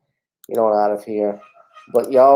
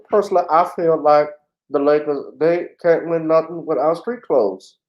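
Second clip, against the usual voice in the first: higher-pitched sports pundit impersonation; Charles Barkley; 5 semitones higher than usual. Acted. Streamer imitating a pundit judging the Lakers, higher put-on voice. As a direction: give a raised-voice take-off of an opinionated commentator. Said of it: "Like Car-, uh, Charles Barkley".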